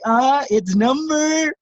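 high, slow, drawn-out tease; mock announcer teasing the winner; suspense buildup.

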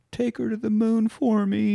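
imitating a high-voiced goodbye; farewell plea. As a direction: act out a tender last wish. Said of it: "and he goes".

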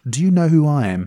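loud, high indignant English toff; estuary english accent. Retold or imitated. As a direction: demand it like an offended Brit. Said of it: "just says".

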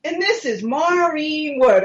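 high, drawn-out introduction of a name; mock TV announcer. TV show naming her to the viewers, mock announcement. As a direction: imitate a narrator announcing her, slowly. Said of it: "Two seconds later".

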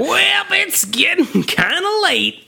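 high, slow put-on Viking voice. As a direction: drawl it out like a Viking warrior. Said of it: "That's my Viking voice".

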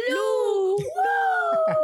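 put-on a toddler squealing for the light; imitating a toddler. Mother imitating son celebrating the power returning, high shout. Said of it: "y me decía".

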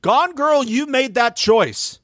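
high, slow, emphatic telling-off; indignant scolding. Pretend. Podcaster putting it on record to Gone Girl, indignant scolding. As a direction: scold her in a high voice, stretching the words.

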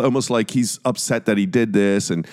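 usual speaking voice

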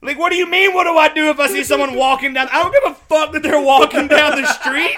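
loud, high, exasperated rant; hammed-up outrage climbing high; indignant retort. Comedian ranting back at the test question, indignant.

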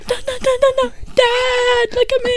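put-on high, pleading little kid; whiny child voice. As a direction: beg like a small child. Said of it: "you'd be like".

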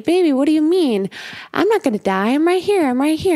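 high, insistent retold reassurance; reassuring father. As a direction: imitate a dad urgently comforting his child. Father reassuring his crying daughter.

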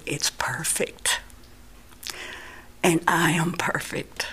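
slow, reverent near-whisper; awed whisper. Retold or imitated. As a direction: whisper it in tearful wonder. Retold vision with tears on cheeks.

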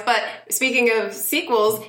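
usual speaking voice

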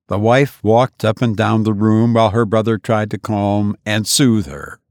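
usual speaking voice

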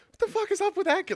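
high, fast, loud mock bystander; quick high-pitched retold disbelief; baffled onlookers. Voicing onlookers across the lake.

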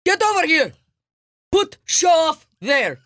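high, loud hammed-up parental yell; mock furious shout. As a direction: bellow it like a cartoon angry dad.

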